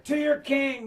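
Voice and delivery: high-pitched calling out; imitating people yelling; crowd's yell. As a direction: cry out to rally the guards. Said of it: "The people yell".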